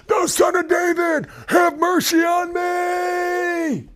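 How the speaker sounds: very high, slow, straining shout. Acted. Beggar shouting over a hushing crowd, desperate straining cry. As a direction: cry out even harder, pleading. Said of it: "he cried the more a great deal".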